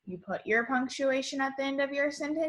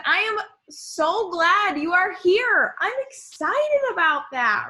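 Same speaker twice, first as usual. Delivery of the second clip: high, loud, slowed-down exclamation; loud, raised-pitch teacherly enthusiasm; excited and loud; 7 semitones higher, 5 dB louder than usual. Shown as a demonstration. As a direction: exclaim it for the class with big excitement. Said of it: "when you are excited, you are loud".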